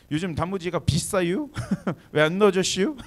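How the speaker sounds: put-on hint around in a teasing Chungcheong accent; Chungcheong dialect. Pastor mimics a Chungcheong caller to the restaurant owner, light Chungcheong accent. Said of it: "돌려서 얘기하는 게 충청도식 스타일이에요".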